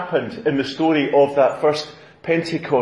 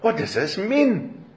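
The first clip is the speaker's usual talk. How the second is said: slow, raised questioning in bewilderment; amazed and perplexed; 7 semitones higher, slower than usual. Acted. Crowd asking one another what this means, perplexed. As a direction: puzzle over it, pitched up. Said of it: "Amazed and perplexed".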